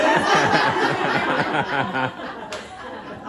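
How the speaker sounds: deep laugh in a low throaty rasp; real laugh. Real, not acted. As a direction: laugh hard at your own joke. Own laugh at her joke.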